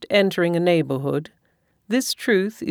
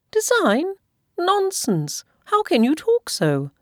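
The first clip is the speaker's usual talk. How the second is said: much higher, scoffing wife; wife scoffing at husband, much higher; indignant wife; 12 semitones higher, slower than usual. A narrator's reading. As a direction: scoff at the silly question. Mrs Bennet indignant.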